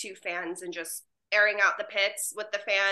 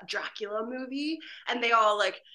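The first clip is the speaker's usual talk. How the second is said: ordinary talk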